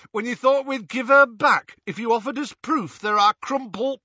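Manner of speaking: loud, high taunting tirade; angry berating. A narrator's reading. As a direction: throw it in the captive's face. Death Eater berating between blasts.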